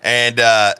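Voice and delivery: ordinary delivery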